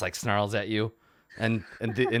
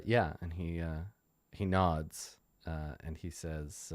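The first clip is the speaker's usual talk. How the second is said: usual speaking voice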